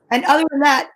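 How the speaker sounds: ordinary delivery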